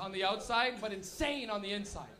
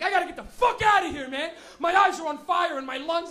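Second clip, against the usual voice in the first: loud choking panic, voice pitched up; panicked shout; 5 semitones higher, 10 dB louder than usual. Retold or imitated. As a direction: yell out of the smoky car. Choking in hotbox.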